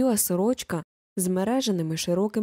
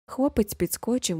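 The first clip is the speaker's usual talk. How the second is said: ordinary delivery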